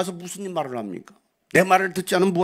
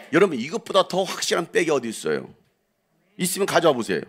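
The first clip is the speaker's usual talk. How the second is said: ordinary delivery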